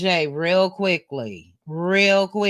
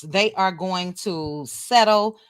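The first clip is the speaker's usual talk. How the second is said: normal talking voice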